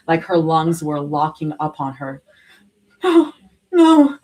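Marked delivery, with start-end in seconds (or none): 3.0-4.2 high, loud gasped panic; panicked gasping denial. A narrator's reading. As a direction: gasp it out in terror. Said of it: "she gasped".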